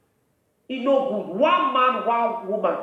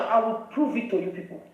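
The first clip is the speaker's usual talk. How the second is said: ordinary talk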